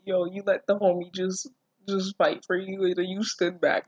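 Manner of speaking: usual speaking voice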